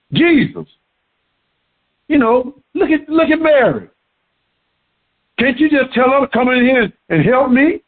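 high, fast, complaining Martha; voicing pushy Martha. Martha complains to Jesus about her sister, whiny and fast. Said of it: "Martha went and kinda jumped Jesus".

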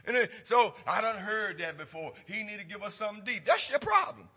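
loud, driving pulpit preaching; preaching at full force. Acted. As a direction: hammer it home to the congregation.

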